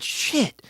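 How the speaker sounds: shit swung up high but kept soft; a hushed, high "shit" from a quiet guy. Retold or imitated. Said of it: "in this, like, hushed tone".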